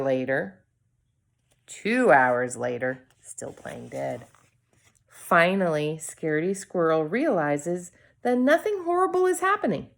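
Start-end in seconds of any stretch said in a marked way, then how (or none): none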